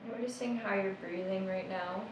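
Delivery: soft, unhurried meditation guide; hushed meditation voice trailing into a whisper; guided meditation. Acted. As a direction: ease them into noticing the breath, gently.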